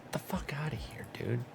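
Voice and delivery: soft, breathy whisper of disgust; whispered disbelief. Real, not acted. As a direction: hiss it under your breath.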